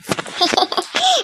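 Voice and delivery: squeaky high laugh; soft high chuckle; high giggle. Acted. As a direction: giggle at her worry. Girl giggles.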